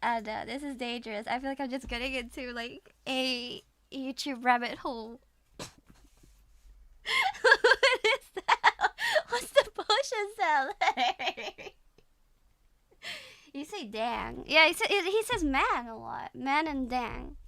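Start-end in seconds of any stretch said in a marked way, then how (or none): 5.6-11.8 high, slow, squeaky-with-laughter question; cracking up, words stretched; helpless laughing. Real, not acted. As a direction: barely get the question out for laughing. Laughing at clip.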